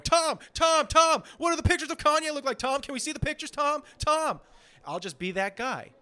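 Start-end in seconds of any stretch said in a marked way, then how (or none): 0.0-4.4 much higher, louder nagging calls of Tom; pestering call. Pretend. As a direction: badger him through the door. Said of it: "hammering on his door going".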